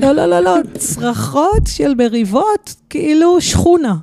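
loud, high correction, slowing on the words; loud emphatic correction. Real, not acted. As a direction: set him straight, loud and slow. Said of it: "כשאת אמרת צרחות, חשבתי ביער צורכים". (תיקון נחרץ בקול רם וגבוה)

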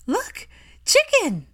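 put-on very high, child's thrilled shout; excited child. A narrator's reading. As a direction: shout it with glee. Scorpius excited with chick.

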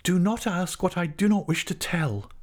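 high, earnest entreaty; begging. A narrator's reading. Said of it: "she begged".

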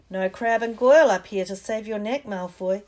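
loud, confident call; taunting call. Acted. Harry goads Malfoy in the air with a loud call. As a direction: goad him. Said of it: "Harry called".

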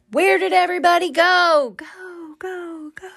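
high call fading into an echo. Shown as a demonstration. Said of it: "Get it? The echo?".

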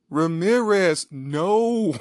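drawn-out, high mock wail; over-the-top slow-motion scream; dramatic cry. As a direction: cry out after a lost comrade, hammed-up. Acted cry after diving officer.

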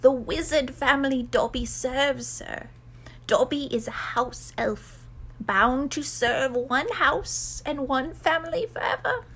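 high, servile creature voice; humble elf, slowing on the last words; house elf. Acted. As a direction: explain as a bound little servant. Plays Dobby the house elf.

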